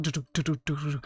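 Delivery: soft, rhythmic du-du trundling; imitated carriage rattle. Shown as a demonstration. Game master imitating a weird carriage sound, soft rattle. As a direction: patter out the carriage sound quietly. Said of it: "like, carriage sound".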